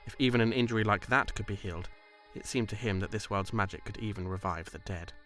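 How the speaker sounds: ordinary delivery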